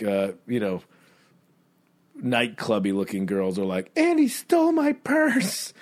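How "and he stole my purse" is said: put-on high, slow whiny drunk girl; mock a shrill drunk girl complaining; hammed-up high drunk woman whining. Drunk girl voiced in story.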